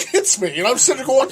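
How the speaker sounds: ordinary delivery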